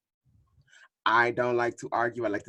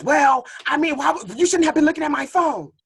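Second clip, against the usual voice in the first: high, louder defensive whine; mocking cheater; 13 semitones higher, 8 dB louder than usual. Imitating a cheating partner blaming you, mocking whine. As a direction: sneer it like a caught cheater. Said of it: "throw it up in your face".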